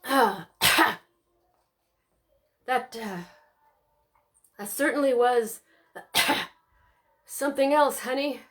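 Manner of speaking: coughing and breathless. A narrator's reading. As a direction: struggle for air after choking. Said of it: "catching her breath".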